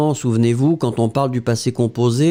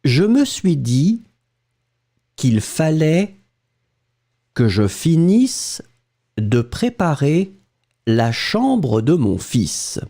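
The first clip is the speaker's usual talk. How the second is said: dictation. Shown as a demonstration. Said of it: "Écoutez et écrivez".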